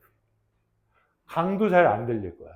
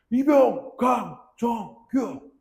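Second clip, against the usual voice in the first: high and slow, each syllable separate; recruit's call-out after a hit; 9 semitones higher, 5 dB louder, much slower than usual. Retold or imitated. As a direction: force out "이병, 강, 정, 규" slowly after being struck. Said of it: "이게 이등병 생활이었어". (턱을 맞고 천천히 높게 외치는 관등성명)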